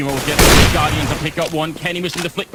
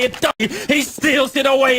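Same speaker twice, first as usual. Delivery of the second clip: higher shouted hype call; shouting; 8 semitones higher, 3 dB louder than usual. Real, not acted. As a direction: yell the steal at full hype. Caster repeats the call, shouting.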